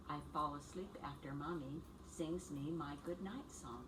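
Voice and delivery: ordinary talk, nothing marked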